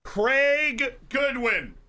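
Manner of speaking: high, loud, stretched-out scorer's name; drawn-out commentator's flourish; amazed name call. Real, not acted. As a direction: announce the hero slowly and emphatically.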